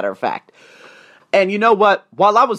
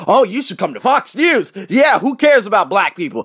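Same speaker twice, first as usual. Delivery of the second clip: higher-pitched mock sneer; mocking heinous commenters; 4 semitones higher, 4 dB louder than usual. Commenters jeering on a YouTube page, mockingly imitated. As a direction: imitate an online heckler, raised and dismissive. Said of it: "those heinous comments on that YouTube page".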